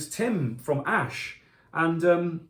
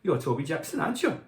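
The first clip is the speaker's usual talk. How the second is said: half-hearted Welsh lilt, jumping up at the end; attempted Welsh accent. Fellow musician approaching the singer backstage, attempted Welsh accent. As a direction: have a rough go at Welsh. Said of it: "I can't do the Welsh accent".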